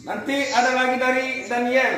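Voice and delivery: ordinary talk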